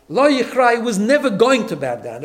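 Lo yichra said loud and high with a strong push; emphatic. Shown as a demonstration. Rabbi driving home Mordechai's refusal, loud emphasis. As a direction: land hard on "never", proud and firm. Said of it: "it's there for emphasis".

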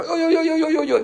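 high, rapid wailing oi oi oi; hysterical oi. Shown as a demonstration. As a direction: wail in mock hysteria. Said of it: "Some people are hysterical".

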